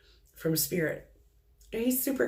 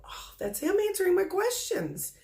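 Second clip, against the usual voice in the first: delighted realization; 12 semitones higher than usual. Pretend. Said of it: "you'll go".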